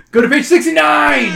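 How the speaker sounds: high, hyped shout. Acted. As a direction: belt out the page number. Hyped page instruction.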